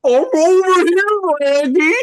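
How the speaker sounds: loud, high call from off-mic. Real, not acted. Guest calling to the host on arrival, loud. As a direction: shout to be found.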